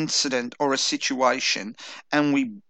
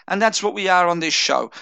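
normal talking voice

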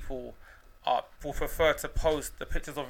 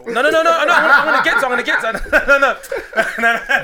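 laughing; 7 semitones higher, 9 dB louder, faster than usual. Real, not acted. Laughing protest, can't finish story.

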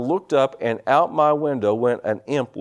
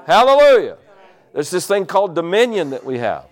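full-force preaching; 2 semitones higher, 4 dB louder than usual. Acted. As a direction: preach it out to the congregation.

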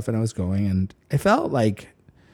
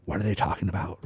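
low and much softer, suspicious; paranoid murmur; 7 semitones lower, 10 dB quieter, faster than usual. Retold or imitated. Eyeing strangers in the next room, low and paranoid. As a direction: mutter it under your breath. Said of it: "I was like".

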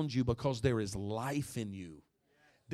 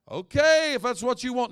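higher, louder pushy hostess; eager host voice; 12 semitones higher, 14 dB louder than usual. Retold or imitated. Host pressing a drink on the pastor, in an eager high voice. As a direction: offer the Coke brightly, insisting. Host giving in, retold scene.

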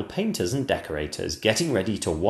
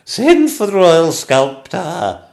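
high, very loud, drawn-out command; a queen ordering imperiously; Queen's order; 9 semitones higher, 12 dB louder, slower than usual. Acted. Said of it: "ordered the Queen".

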